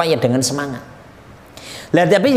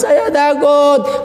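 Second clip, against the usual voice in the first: high, drawn-out mock wail; imitated crying; 8 semitones higher, much slower than usual. Weeping soul at the bridge, acted frightened cry. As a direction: wail it slowly as if in tearful fear. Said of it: "Ketika pada nangis".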